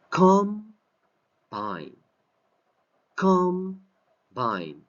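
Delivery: "Combine" with COM high and loud, then a drop; "Combine" as a noun, slowly split, stressed COM; stress on first syllable. Shown as a demonstration. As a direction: punch the COM in "Combine", let "bine" fall away. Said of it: "as a noun".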